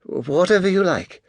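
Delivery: a hint of a cowed waitress; flustered waitress. A narrator's reading. Flustered waitress placating young customer.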